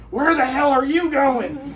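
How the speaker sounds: slow, high-pitched imitation of her shout; mock indignant yell. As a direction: call after them as the irate stranger. Retold lady calling after them.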